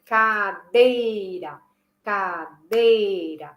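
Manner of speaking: Cadeira slowly, syllable by syllable, dei emphasised; stress on the strong syllable. Shown as a demonstration. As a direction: mark the beat of Cadeira on dei. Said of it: "Pra dar ênfase da sílaba forte". (Cadeira bem lenta, com ênfase na sílaba forte)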